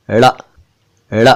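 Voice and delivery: ड़ as a retroflex flap; flapped ड़. Shown as a demonstration. Teacher presenting the second sound, retroflex ड़. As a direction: flick the tongue off the palate for ड़. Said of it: "जबान को तालू से touch".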